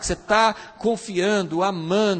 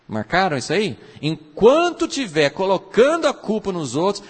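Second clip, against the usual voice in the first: ordinary talk, nothing marked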